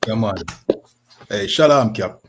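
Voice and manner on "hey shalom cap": usual speaking voice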